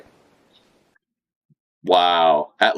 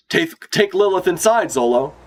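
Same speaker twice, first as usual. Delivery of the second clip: urgent order; 9 semitones higher than usual. Acted. Reading a character's order.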